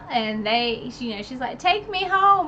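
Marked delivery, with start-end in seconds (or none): 1.6-2.5 higher, louder pleading line; a lightly voiced urgent plea; Feyre's request.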